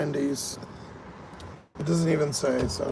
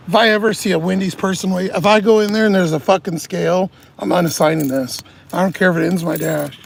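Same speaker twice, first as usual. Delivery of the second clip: louder, fast, venting fury; angry rant; 5 dB louder than usual. Real, not acted. Driver ranting to viewers about the weigh rule, angry and loud. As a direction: let the anger spill out at full volume.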